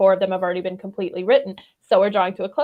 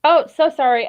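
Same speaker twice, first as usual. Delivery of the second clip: ordinary delivery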